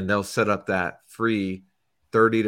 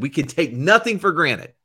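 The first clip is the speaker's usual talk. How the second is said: raised, louder coach voice, punching NOTHING; imitated coach's address; 5 semitones higher, 6 dB louder than usual. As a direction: quote a coach laying down the law. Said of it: "He said".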